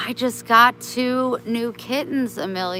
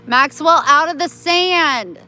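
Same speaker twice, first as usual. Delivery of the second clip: loud, higher call to the dog; louder, higher scolding call to a dog; 7 semitones higher, 8 dB louder than usual. Real, not acted. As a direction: raise your voice to call the dog back. Calling dog out of sand.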